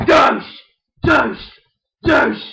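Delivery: loud high yelled insult; insult shout. Pretend. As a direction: spit it out in a yell. Toy insult battle.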